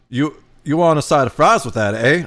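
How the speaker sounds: half-hearted imitation of a Canadian waiter; bad Canadian accent. Diner imitating a waiter in Toronto, poorly faked Canadian accent. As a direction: attempt a Canadian accent, ending on "eh". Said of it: "I can't do the Canadian accent".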